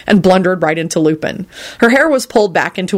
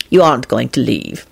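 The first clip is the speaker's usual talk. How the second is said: low, resigned statement; 5 semitones lower than usual. A narrator's reading. Lupin accepting Quentin will stay, resigned. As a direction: give in, voice sinking. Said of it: "he stated resignedly".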